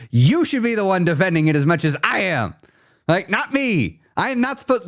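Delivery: high, heated mock rant; mock exasperation. Real, not acted. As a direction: act fed up but playful.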